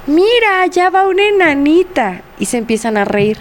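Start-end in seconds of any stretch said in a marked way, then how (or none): 0.1-2.2 mocking older boy. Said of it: "dice un niño ya más grande que dice".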